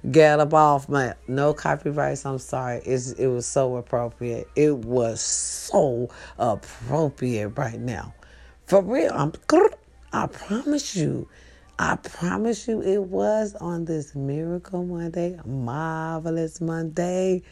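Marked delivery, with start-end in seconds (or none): 0.1-1.1 louder and louder, forceful command; building preacher-style rebuke; rebuking the devil. Shown as a demonstration. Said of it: "in your mind, be like". 4.5-8.1 slow emphatic. Real, not acted. Stretched emphatic repeat. 15.5-17.4 slow savoring. Real, not acted. Savouring the phrase.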